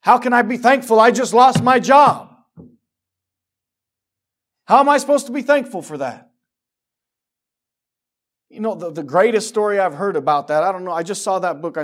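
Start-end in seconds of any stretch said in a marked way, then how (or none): 0.0-6.3 high, full-force indignant shout; loud, exasperated cry at the top of the voice; shouted protest. Acted. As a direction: yell it like an outraged complaint. Voices protesting person who lost job.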